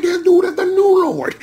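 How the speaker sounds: awed shout. Retold or imitated. Proclaiming the new rider the lord with a high shout. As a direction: crown him. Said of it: "I'm like".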